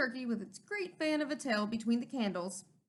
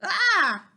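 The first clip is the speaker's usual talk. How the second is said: loud high startled cry; Turkey's loud frightened squawk; 7 semitones higher, 11 dB louder than usual. Acted. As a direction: squawk in alarm.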